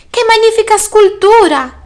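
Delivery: very high, loud admiration; thrilled snail gushing praise; awestruck exclamation. A narrator's reading. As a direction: marvel at it in a high delighted voice. Snail admires the beetle's dung ball.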